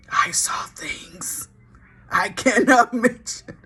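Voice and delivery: slow unvoiced awestruck whisper; mock shocked whisper; stunned whisper. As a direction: breathe it like you've seen horrors. Imitating stunned Fred, whispered.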